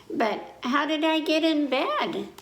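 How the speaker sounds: child asks how he got to bed, lightly voiced; child asking. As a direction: wonder aloud like a sleepy kid. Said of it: "I asked".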